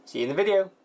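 normal talking voice

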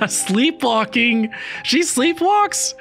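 high, drawn-out disbelief; high-pitched delighted astonishment; amused surprise. Real, not acted. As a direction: squeal it slowly, incredulous. Reacting amused to trailer.

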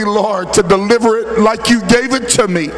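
usual speaking voice

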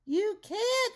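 very high, loud panic; screamed. A narrator's reading. Rumpelstiltskin grabs for the staff, high panicked scream. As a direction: scream it far above normal pitch. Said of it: "Rumpelstiltskin screamed".

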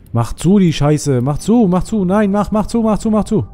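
loud, high, panicked repetition; climbing urgent yell; frantic shouting. Real, not acted. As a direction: scream for it to shut. Frantic to shut the door.

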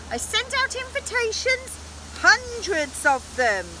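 high-pitched storybook witch; squealing witch. A narrator's reading. Witch explaining the birthday party to the knight, squealing. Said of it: "squealed the witch".

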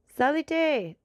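high, loud summons to a servant; commanding order. A narrator's reading. Count summons the messenger from the street, commanding. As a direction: call it out as a master's order. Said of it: "same tone in which he would have given an order to his servant".

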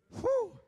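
high, loud exhaled "whew"; whoop. Real, not acted. Preacher after declaring "not guilty", loud high whew.

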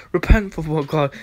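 usual speaking voice

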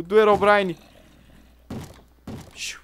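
ordinary talk, nothing marked

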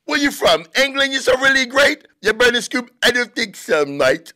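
very high, squeaky fake London accent sliding into Australian; mock bad London accent. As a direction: mimic a terrible British accent way up high. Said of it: "terrible accent".

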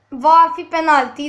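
normal talking voice

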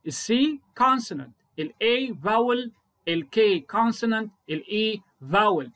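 الA فاول drawn out at half pace; slow explanation. Shown as a demonstration. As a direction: stretch each word. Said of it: "وأوضح له". (ببطء شديد)

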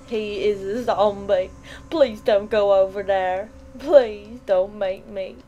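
loud pleading; loud scared begging; loud dread. Real, not acted. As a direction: beg the game not to make you go. Zombie dread, pleading with game.

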